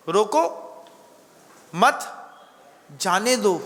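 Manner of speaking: each word set apart by a pause, no grouping; separate words. Shown as a demonstration. Preacher quizzing listeners on the comma, separate words. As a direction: say रोको, मत, जाने दो word by word with gaps. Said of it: "comma कहाँ लगाना है".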